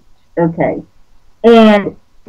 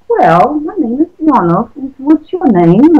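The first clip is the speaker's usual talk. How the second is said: light, playful London Cockney, a touch higher; playful Cockney accent; 6 semitones higher than usual. Pretend. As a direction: put on a cheery Cockney for a silly mood. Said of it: "it sounds Cockney".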